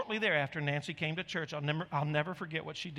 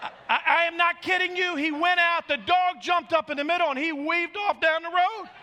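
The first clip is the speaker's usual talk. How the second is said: high, loud insistent exclamation; loud disbelief; 13 semitones higher, 9 dB louder than usual. Real, not acted. Preacher swearing to congregation the dog story is true, loud disbelief. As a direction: belt it out over the laughter, amazed. Preacher incredulous, crowd laughing.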